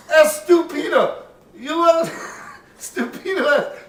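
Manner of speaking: loud, high mock praise turning to insult; mock teacher. Nun teasing the student, loud high mock praise. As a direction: ham up a teacher crowing estupendo, stupido.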